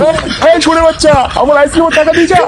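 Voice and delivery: high yell after a runaway; calling out. Acted. Shopkeeper yelling after boy who fled without paying. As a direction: shout after him, half laughing.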